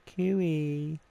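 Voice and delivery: high, drawn-out name call; imitating a high singsong summons; retold call-over. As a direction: call someone over in a lifted voice. Retold calling the speaker over.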